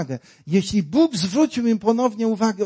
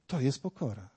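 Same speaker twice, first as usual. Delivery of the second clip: hushed; 5 semitones lower, 11 dB quieter than usual. Shown as a demonstration. Repeated line said near-silent.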